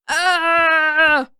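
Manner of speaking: sharp high loud yelp of pain. A narrator's reading. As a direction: yelp as the paw grabs him. Sokka grabbed by the paw.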